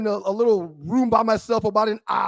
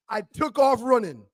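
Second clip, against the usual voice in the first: ordinary talk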